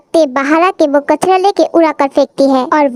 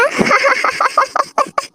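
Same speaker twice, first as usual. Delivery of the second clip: gloating laugh; 8 semitones higher, 5 dB quieter than usual. Acted. Laughs at splattered boy.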